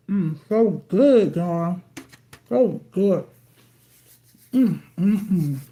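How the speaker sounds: savoring the sandwich, mouth full; slow, relishing mm with food in mouth. Real, not acted. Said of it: "Don't talk with my mouth full".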